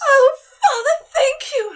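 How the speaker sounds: high, loud, tearful thank you; overjoyed cry. Acted. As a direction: burst out with grateful joy. Delighted daughter cries out.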